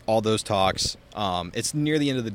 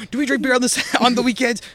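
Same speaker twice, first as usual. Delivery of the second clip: much higher, louder furious mock roar; imitated drill sergeant yell; 8 dB louder than usual. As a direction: do the gunny tearing into a recruit. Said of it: "Fucking grilling into this kid".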